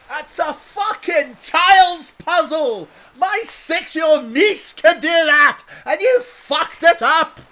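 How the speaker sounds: furious high Scottish bellow; slow, full-force Scottish tirade at top volume; shouty Scottish. Acted. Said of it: "shouty Scottish people".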